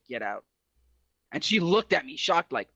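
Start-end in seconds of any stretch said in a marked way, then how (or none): 0.1-0.5 low quiet order. Retold or imitated. Staring at the girlfriend, a low quiet get out. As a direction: lower and softer, cold and final. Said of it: "And I don't yell".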